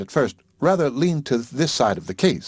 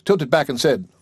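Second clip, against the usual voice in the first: usual speaking voice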